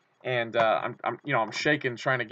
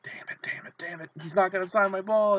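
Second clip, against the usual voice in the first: hissed, panicky whisper; a desperate whispered "damn it" as time runs out; frantic whisper; 7 semitones higher than usual. Retold or imitated. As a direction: whisper curses under your breath. Frantic as signing ends.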